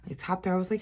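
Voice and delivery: ordinary talk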